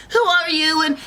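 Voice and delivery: put-on high, loud startled girl; alarmed Goldilocks. Girl confronting three strange bears, high alarm. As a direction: demand it in a high panicky voice. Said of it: "And Goldilocks is like".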